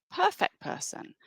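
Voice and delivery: high, soft mock-admiring "Perfect person"; ironic echo. Shown as a demonstration. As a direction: say it high and sarcastic, as if faking praise.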